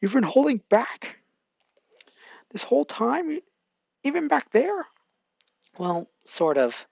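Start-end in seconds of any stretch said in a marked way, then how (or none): 0.0-4.9 confused. Acted. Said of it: "Baku, confused".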